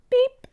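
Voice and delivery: loud high-pitched beep; sharp little horn toot; little truck's beep. A narrator's reading. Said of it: "Blue said".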